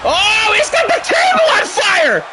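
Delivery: real excited yell; excited shout. Real, not acted. Table set on fire in match.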